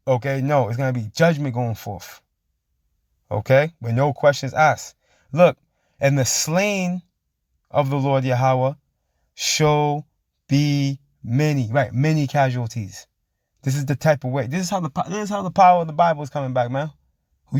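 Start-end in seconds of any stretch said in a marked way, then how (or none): 6.0-11.7 very slow, drawn-out verse reading; preached scripture. Acted. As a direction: stretch each word like a proclamation. Reads scripture aloud.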